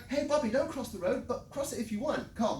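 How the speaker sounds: put-on mild, casual warning to a child; easygoing parent. Parent to child about crossing the road, half-hearted. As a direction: warn the kid with no real urgency. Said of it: "I know that's a bit dramatic".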